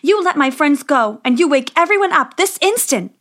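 insistent, building upward in pitch; indignant command. A narrator's reading.

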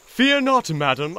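high, loud heroic flourish; gallant hero. Acted. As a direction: declare it like a storybook knight. Toad playing gallant rescuer.

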